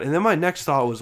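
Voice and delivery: normal talking voice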